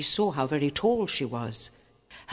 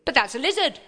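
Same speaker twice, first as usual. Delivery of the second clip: higher, put-on cook voice, disapproving; frowning cook; 10 semitones higher, 4 dB louder, faster than usual. A narrator's reading. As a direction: frown through the words. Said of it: "frowned the cook".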